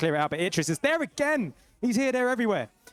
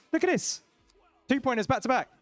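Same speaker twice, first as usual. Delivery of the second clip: excited call; 6 semitones higher than usual. Real, not acted. Caster hypes back-to-back scores.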